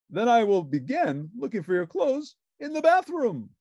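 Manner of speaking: grand, self-important sleuth; Sherlock Hemlock voice. A narrator's reading. Detective answering Ernie's call, pompous and grand.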